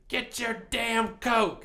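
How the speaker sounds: high-pitched scolding mom voice; mock yell in mom's voice; imitated yelling mom. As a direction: order the kid like a fed-up mother. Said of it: "she's ever yelled at me".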